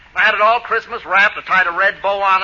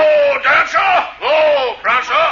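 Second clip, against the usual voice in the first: long-drawn, raised Santa holler; calling to reindeer; 6 semitones higher, much slower than usual. Acted. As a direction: rein in the team with drawn-out whoas. Fake Santa halting his reindeer.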